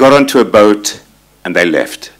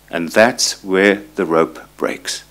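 slow emphasis; 3 semitones lower, 6 dB quieter, slower than usual. Real, not acted. Punchline of the rope metaphor again.

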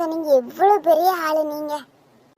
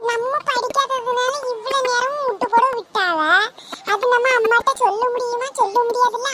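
put-on child voice; 6 semitones higher than usual. Children talking after tuition.

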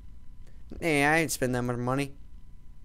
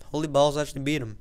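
ordinary delivery

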